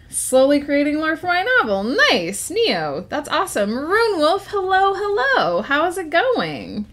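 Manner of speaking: put-on high-pitched old lady warlock; Afima voice. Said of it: "Afima is the voice I was just doing".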